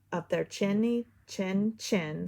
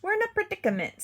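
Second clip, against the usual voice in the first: high, fast, brave front; cat putting on courage; bravely; 12 semitones higher, much faster than usual. A narrator's reading. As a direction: rush it out bravely. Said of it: "said Cat bravely".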